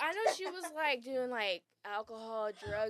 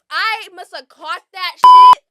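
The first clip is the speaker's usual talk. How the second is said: excited; 5 semitones higher, 25 dB louder, slower than usual. Real, not acted. Excited retelling of catching ball.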